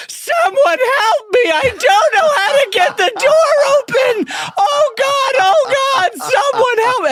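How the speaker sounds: very high, loud panicked holler; hammed-up full-volume American scream, drawn out; screaming tourist. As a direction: scream for help in a big American accent. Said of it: "screams in the loudest American accent".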